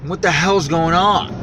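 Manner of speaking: puzzled and spooked; baffled and rattled; alarmed. Real, not acted. Reacting to spooky lock.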